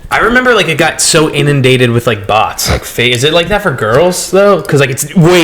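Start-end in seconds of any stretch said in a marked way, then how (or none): none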